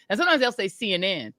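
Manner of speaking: usual speaking voice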